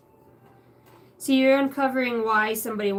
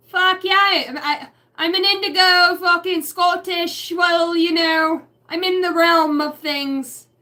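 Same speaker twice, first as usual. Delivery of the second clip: 1 hammed-up Scottish; 7 semitones higher, 9 dB louder than usual. Drunk party Scottish persona.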